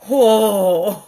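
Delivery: a loud, stunned whoa breaking into a laugh; shocked whoa then laugh. Retold or imitated. Reacting to the rushed lady with a loud stunned whoa. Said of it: "I was pissed at that point".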